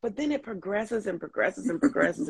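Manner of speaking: ordinary talk, nothing marked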